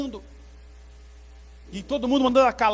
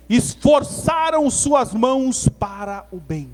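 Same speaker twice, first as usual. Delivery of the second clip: raised, declamatory verse reading; scripture reading; 2 semitones higher, 4 dB louder than usual. A narrator's reading. Preacher reading Neemias's declaration to the church, emphatic proclamation. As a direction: proclaim the verse, slowing into the last words.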